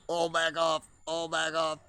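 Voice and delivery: high, loud shooing order; mock-stern back off; bossy bird voice. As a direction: voice the hens shooing the newcomer. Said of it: "They were like".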